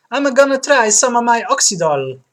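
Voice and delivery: high, loud Italian barber; Italian accent. Acted. Barber welcoming a customer, Italian accent. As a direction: play an exuberant Italian barber. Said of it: "platinum blonde with Italian accent".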